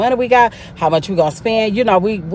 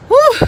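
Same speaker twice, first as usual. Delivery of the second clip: a loud shaky exhale before opening up; emotional whew; 7 dB louder than usual. Real, not acted. Vlogger steadying herself before getting emotional, loud whew. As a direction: blow out the nerves with a loud whew. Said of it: "I feel like I'm about to get emotional".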